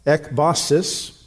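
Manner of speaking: ekbasis said slowly as EK-ba-sis, each syllable clear; ekbasis, slow and careful, a rise at the end; Greek word said slowly. Shown as a demonstration. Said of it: "It's the Greek word".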